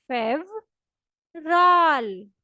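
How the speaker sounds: फेवराल very slow, with v not b; Russian fevral. Shown as a demonstration. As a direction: stretch फेवराल out clearly. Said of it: "दोबारा मेरे साथ बोलें". (फेवराल को बहुत धीरे, साफ़ बोलें)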